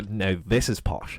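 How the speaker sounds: a light touch of a posh South Dublin voice, slow; lightly put-on posh accent, drawn out. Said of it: "I even sound posh there".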